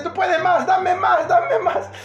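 high, loud, insistent cheering; mock urging on. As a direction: egg it on with rising excitement. Comically urges the tamal out.

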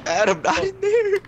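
high, slow panicked shout; being chased. Real, not acted. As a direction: yell a desperate warning. Friend runs into enemy spot.

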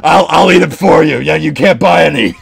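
loud, hammed-up ad spot; playful pushy pitchman; mock commercial. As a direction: shout a made-up sales pitch. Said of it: "Dom just makes up a stupid commercial".

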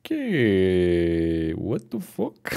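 soft, drawn-out okay, then baffled; quietly taken aback by a twist; stunned. Real, not acted. (leise und fassungslos)

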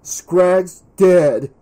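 loud, punched announcement; loud and urgent; breaking shocking news. A narrator's reading. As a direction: blurt out the big news, out of breath. Breathless boy announces shocking news.

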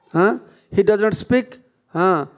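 usual speaking voice

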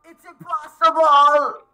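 a loud despairing protest; drawn-out cry. Real, not acted. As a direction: wail it out in disbelief. Frustrated outburst at the game.

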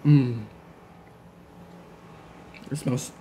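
chewing while talking; mouth full. Real, not acted. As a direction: food in cheeks. Seen 1: Cheeks bulge, closed lips, chewing motions; pizza itself not visible.

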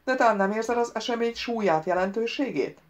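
loud, pressing reproach; reproachful. A narrator's reading. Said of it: "a hang szemrehányóan". (szemrehányó, erélyes hangon)

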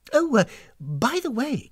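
high, casual lilt; change the subject breezily; airy. A narrator's reading. Hostess lightening the mood with guest, airy. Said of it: "she remarked airily".

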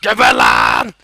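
"Geber lan" roared very low and very loud; monster growl. Acted. Gamer roaring at a monster in a beast voice. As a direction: roar it like a beast.

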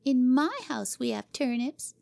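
put-on high, proud child's boast; boastful girl. Cora bragging to Jimmy: proud high girl voice. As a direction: stress on my, showing off.

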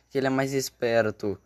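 normal talking voice

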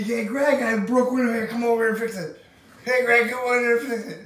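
a lightly voiced dad hollering for Greg; calling out. Dad calling his son to fix the window, lightly voiced holler.

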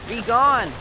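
loud sudden command; shout. Real, not acted. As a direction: yell it away. Banishing popup on screen.

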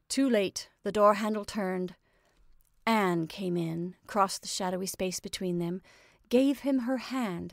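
plain reading voice

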